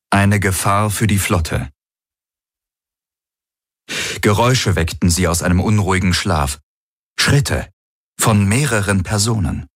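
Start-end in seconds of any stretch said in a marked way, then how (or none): none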